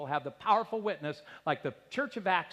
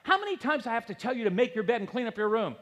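higher, exasperated nag; nagging mom; 6 semitones higher, 4 dB louder, faster than usual. Retold or imitated. Preacher imitating a mom nagging her kid. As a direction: scold like a fed-up mother. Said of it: "Moms complain".

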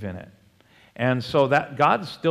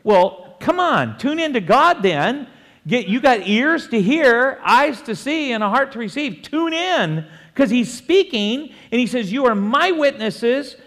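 high, loud, insistent preaching; exhorting preacher; 9 semitones higher, 5 dB louder than usual. Acted. Preacher telling doubters to listen to God, insistent exhortation. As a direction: urge the congregation to tune in. Exhorts the congregation at full force.

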